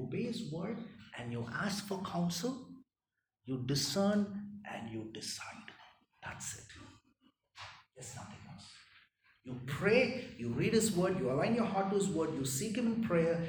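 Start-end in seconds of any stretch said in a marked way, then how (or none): 4.7-8.7 hushed, breathy whisper. Real, not acted. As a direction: drop to a whisper to land it. Sermon drops to hushed point.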